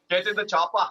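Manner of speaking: put-on Austrian action-hero accent; mock Austrian tough guy, amused; Schwarzenegger. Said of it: "[name] says Arnold Schwarzenegger".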